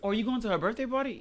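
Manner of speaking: passive-aggressive jab. Acted. Girlfriend needling her man about a party, passive-aggressive. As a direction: ask it as a sly little jab. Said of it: "small jabs".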